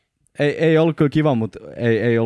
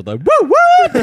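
high, loud drawn-out "woo woo"; embarrassing hooting noise; 12 dB louder, much slower than usual. Real, not acted. Mocking the hype noise among co-hosts, loud high hooting. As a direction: hoot it long and shamelessly high. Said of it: "Tää on muuten ihan vitun nolo melsu".

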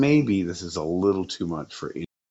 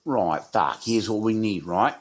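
lowered, slowed mock English gangster voice; criminal accent; 2 semitones lower than usual. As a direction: growl it like a cockney villain planning a job. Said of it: "every criminal who does some shit is like".

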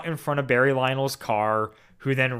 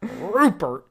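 a hint of gravel on Rupert, higher; gravelly; 5 semitones higher than usual. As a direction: growl out Rupert like a grumpy old man. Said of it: "You gotta get really gravelly there".

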